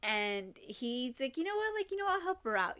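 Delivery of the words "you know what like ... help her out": much higher, fast and loud sly voice; hammed-up plotting brother, pitched way up; scheming brother. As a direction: cook up the plan with glee. Brother decides to help her.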